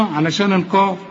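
ordinary talk

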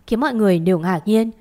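usual speaking voice

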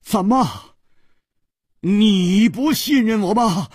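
又高又响，放慢了质问; 猛地一惊，愤然反问; 跳起来质问. Acted. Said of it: "姬冰燕跳起来说". (姬冰燕冲着楚留香愤然质问)

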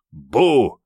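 sudden loud "boo"; startling shout. Acted. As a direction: jump out to scare a friend. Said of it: "резко выплыл с криком".

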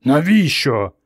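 a touch of irritation; mildly annoyed, croaked question; irritated wheeze. A narrator's reading. Man with unfinished papers challenges the almighty, mildly irritated. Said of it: "роздратовано захрипів чоловік".

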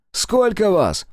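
high loud demand called across; shouted question. Acted. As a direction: bark the question at him. First time asking, shouted. (громкий требовательный вопрос)

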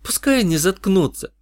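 tossed off sharply, high; snapped. Acted. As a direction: snap it out in disgust. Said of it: "бросила Джана".

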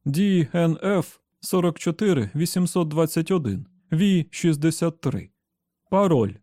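loud firm recitation; reading out loudly. Acted. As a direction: call the number out clearly to the robot. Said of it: "зачитав його голосно".

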